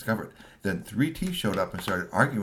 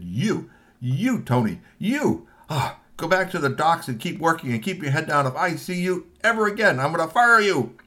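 higher, louder threat building to a shout; angry threat; 9 semitones higher, 6 dB louder than usual. Acted. Boss threatening Tony with firing, rising to a loud threat. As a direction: warn him off, angrier to the end. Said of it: "looked at Tony and said".

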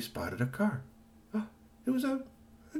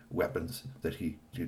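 ordinary delivery

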